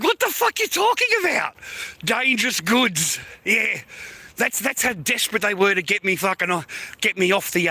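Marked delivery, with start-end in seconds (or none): 0.0-1.5 much higher-pitched disbelief; incredulous outburst. Real, not acted. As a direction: blurt it out in exasperated disbelief. Incredulous at the reported reason.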